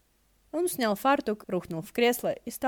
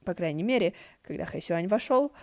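usual speaking voice

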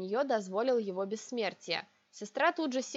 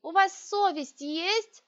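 outraged shout; 9 semitones higher, 5 dB louder, slower than usual. A narrator's reading. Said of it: "я сорвалась на крик".

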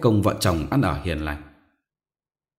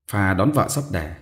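ordinary delivery

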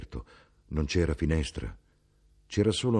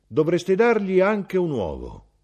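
mother instructing; 13 semitones higher, 7 dB louder than usual. Acted.

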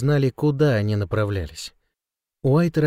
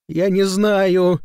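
high, slow sobbing cry; 7 semitones higher, 4 dB louder, much slower than usual. A narrator's reading. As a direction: sob the words out loud. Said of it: "всхлипнул Санти".

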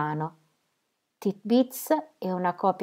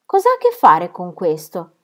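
louder indignant cry; 8 dB louder than usual. A narrator's reading. Lucy crying out at Fred's accusation, loud. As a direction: fire the question back at him. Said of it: "gridò".

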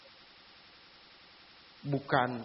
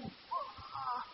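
ordinary talk

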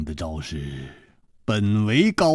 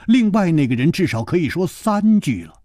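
ordinary delivery

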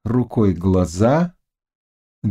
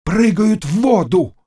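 high cry of horror; very high, alarmed yell; terrified shout; 12 semitones higher than usual. A narrator's reading. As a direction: shout in fright at what is coming. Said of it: "крик ужаса и испуга".

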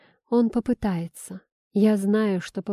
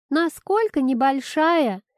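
raised, high, slow question; shouting back; 6 semitones higher, 4 dB louder, slower than usual. A narrator's reading. Kira answers father from the bedroom, shouting back. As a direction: call back across the flat. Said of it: "крикнула она в ответ".

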